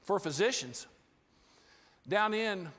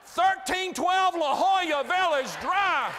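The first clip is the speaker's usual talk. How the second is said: mock accusing; 9 semitones higher, 7 dB louder, slower than usual. Real, not acted. Bitterly naming the store's address.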